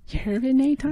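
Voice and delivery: high squeaky rising "Urinaton?"; Fran Drescher voice. Acted. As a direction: squeal the word back. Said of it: "How does Fran Drescher talk?".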